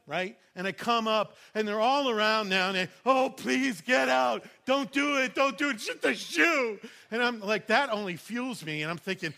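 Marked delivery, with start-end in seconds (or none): 3.0-6.8 pleading shout. Retold or imitated. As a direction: beg him to get out of the river. Church friends beg him out of water.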